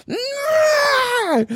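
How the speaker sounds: very high shrieked nah; mock yell. The line cutter refusing the narrator, high mock shriek. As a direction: fling it out like a tantrum. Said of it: "she's like".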